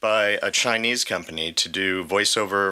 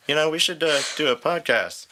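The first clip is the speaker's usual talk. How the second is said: quoting himself; 7 semitones higher than usual. Retold or imitated. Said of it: "I was like".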